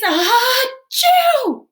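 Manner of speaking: high, loud explosive sneeze; huge sneeze. Acted. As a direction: let the sneeze burst out. Big sneeze.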